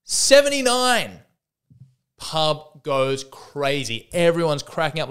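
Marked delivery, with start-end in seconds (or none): 0.1-1.2 loud, slow, high shout; a newcomer hollering his number, drawn out and high; yelling out. Acted. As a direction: belt it out to the whole pub. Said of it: "he yells out".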